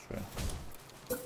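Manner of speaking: breathy whisper to himself. Real, not acted. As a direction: whisper it as an aside. Whispered aside.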